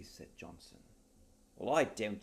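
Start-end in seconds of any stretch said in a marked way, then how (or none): 1.6-2.2 louder gruff retort; a hint of a gruff nobleman; gruff duke. Duke interrupting gruffly, louder.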